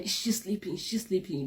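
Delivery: hushed, secretive check; whispered question. Retold or imitated. Asking friend about sleeping roommate, hushed. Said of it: "I kept on asking my friend".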